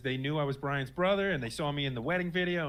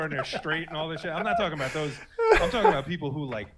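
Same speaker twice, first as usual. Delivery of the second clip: usual speaking voice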